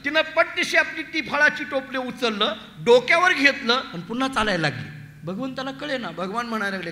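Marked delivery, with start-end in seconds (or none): none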